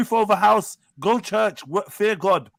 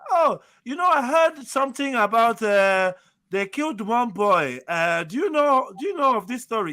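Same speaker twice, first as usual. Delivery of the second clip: Ghanaian mum impression; 2 semitones higher than usual. Imitating a Ghanaian mother telling her son about the killed boy, Ghanaian accent. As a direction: play a Ghanaian mother asking about the killings. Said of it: "That's my best Ghanaian impression".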